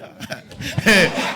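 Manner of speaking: breathless high-pitched laugh. Real, not acted. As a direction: burst out laughing. Laughing at mother's reply.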